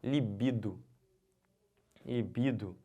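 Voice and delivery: "Libido" as liBIdo; stress on BI. Shown as a demonstration. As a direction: say "Libido" with the weight on BI. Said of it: "a pronúncia correta é libido". ("Libido" com a tônica no BI)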